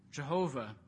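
normal talking voice

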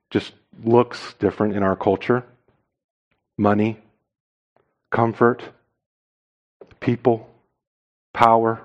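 low, soft sermon with weighty pauses; hushed solemn preaching. Real, not acted. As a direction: let each item sink in, quietly and low.

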